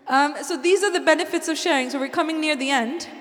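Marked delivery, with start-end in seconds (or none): none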